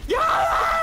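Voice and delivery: high, loud holler; shouted callout. Real, not acted. Player hollering to teammate Lex, high shout. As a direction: belt it out high.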